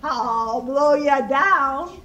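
loud, drawn-out wolf threat; threatening wolf. A narrator's reading. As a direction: stretch the threat out with force. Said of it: "the wolf replied".

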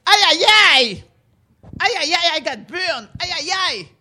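loud high ay ay ay lament; Haitian pain cry. Shown as a demonstration. Teaching children how Haitians cry when burned, loud lament. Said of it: "But if you're Haitian".

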